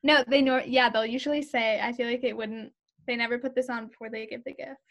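usual speaking voice

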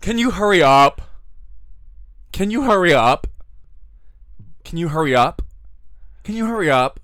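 high, loud bitchy nag; catty. Retold or imitated. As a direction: throw your neck into it. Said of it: "Do I sound bitchy when I say it".

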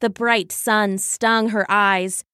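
ordinary delivery

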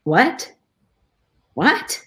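stunned questioning, pitch jumping up; re-enacted disbelief. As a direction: relive the shock, rising sharply. Re-enacts shock at the diagnosis.

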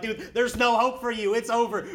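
blunt, crushing finality; dismissive friend. Retold or imitated. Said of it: "my best friends are like".

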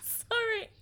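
high voice breaking into laughing tears; tearful. Real, not acted. Co-host overcome while praising friend, tearful apology. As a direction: laugh and cry at once.

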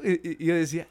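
ordinary delivery

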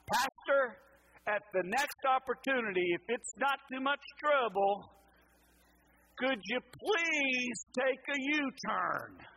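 high, loud mock exasperation, drawn out on please; mad GPS voice. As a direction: act a fed-up satnav pleading sarcastically. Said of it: "That's sometimes how I heard her voice".

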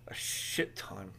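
whispered aside; hushed, slow confession. Real, not acted.

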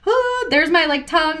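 high, mock-mortified gasp; a high gasp at her own slip; embarrassed gasp. Bartender realizing her rude joke, high mock embarrassment. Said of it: "And I was like".

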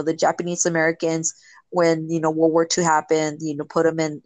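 usual speaking voice

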